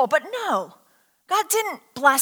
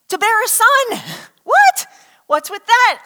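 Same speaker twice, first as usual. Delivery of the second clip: high, loud incredulous "what?!"; baffled, pitch jumping up; amused disbelief; 8 semitones higher, 7 dB louder than usual. Acted. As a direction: blurt it out, laughing in disbelief. Mock disbelief at elderly pregnancy.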